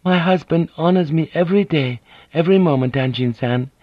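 a poised noblewoman smoothing things over; polite Japanese lady. A narrator's reading. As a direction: voice Mariko as a courteous, composed wife. Narrator voices Mariko.

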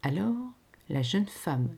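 ordinary delivery

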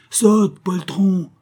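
high, loud, drawn-out order; shouting. A narrator's reading. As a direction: shout the command across the water. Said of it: "cria Raoul".

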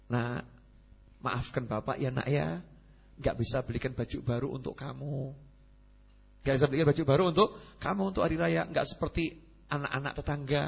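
soft, apologetic father's voice; a hint of a tired father saying sorry; gentle father. Poor father apologizes to son.

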